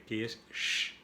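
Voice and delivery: "sh" hissed with no voice; teacher shows the sound of "sh". Shown as a demonstration. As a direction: demonstrate the bare "sh" sound. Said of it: "Viene la C y la H".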